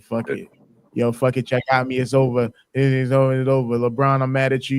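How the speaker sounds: low, resigned mock loser; imitating someone who sounds sad and beaten; defeated. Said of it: "he sound defeated as hell".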